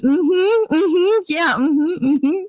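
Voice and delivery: high-pitched imitation of nodding-along relatives; agreeing relatives. Relatives going along with grandmother, mock eager agreement.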